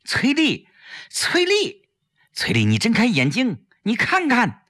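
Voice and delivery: rising cries of her name; calling her name loudly. Acted. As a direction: try to rouse a fainting friend. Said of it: "大声叫着她的名字".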